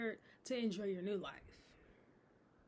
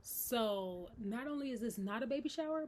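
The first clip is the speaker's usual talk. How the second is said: usual speaking voice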